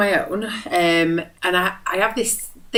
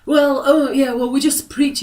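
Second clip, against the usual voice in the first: mock preacher; 8 semitones higher, 4 dB louder than usual.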